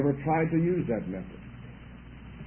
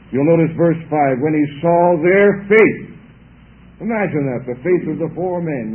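fervent preaching; 7 dB louder than usual. Real, not acted. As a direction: preach it out loud to the congregation. Preacher rises to full force.

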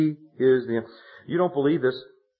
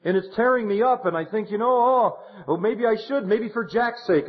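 higher, strained, imitating her distress; tearful widow; 10 semitones higher than usual. Pastor imitating the widow torn up about suing, higher and strained. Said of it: "She said".

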